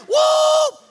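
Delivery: high, loud whoop; piercing "woo" from the pews; excited whoop. Acted. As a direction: let out a revival holler. Imitates excited churchgoer's whoop.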